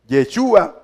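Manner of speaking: Yechúa with an "e" in the first syllable; Yechúa, opening on "ye", stressed on "chú"; name variant. Shown as a demonstration. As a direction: say Yechúa as "ye-CHU-a". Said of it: "Se lo explico".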